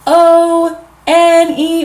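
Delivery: O N E said slowly and separately; teacher spelling each letter slowly. Shown as a demonstration. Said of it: "spell a number".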